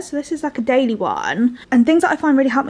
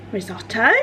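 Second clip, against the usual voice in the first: normal talking voice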